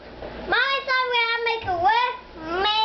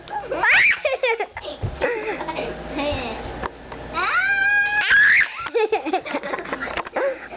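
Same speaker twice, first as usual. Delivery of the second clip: laughing shriek; 2 semitones higher than usual. Real, not acted. Dog at the camera.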